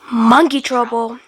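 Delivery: usual speaking voice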